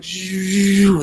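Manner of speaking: a high, rushing gust of wind, imitated; wind whoosh. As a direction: mimic a sudden gust sweeping past, then cut it off. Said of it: "it would just be like".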